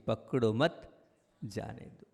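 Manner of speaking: "पकड़ो मत" together, pause, then "जाने दो" dropping very soft; pause after मत. Shown as a demonstration. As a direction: join मत to पकड़ो, let जाने दो fall away. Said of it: "यह बात मोक्ष मार्ग की है".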